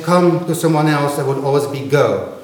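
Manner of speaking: ordinary delivery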